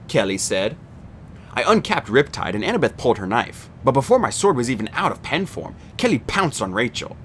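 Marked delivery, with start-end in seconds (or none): none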